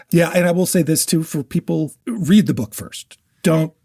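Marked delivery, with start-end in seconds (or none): none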